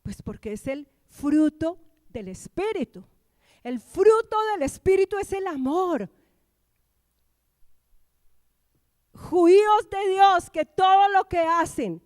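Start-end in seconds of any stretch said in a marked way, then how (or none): 3.6-12.0 loud, high preacher's proclamation; forceful preaching. Real, not acted. Preacher proclaiming that God's fruit is love, forceful preaching. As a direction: proclaim it at full force to the room.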